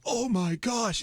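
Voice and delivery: high, startled gasp of a stranger; a passerby seeing a bloody driver, gasps in shock; shocked bystander. Retold or imitated. Startled high oh my gosh. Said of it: "and he goes".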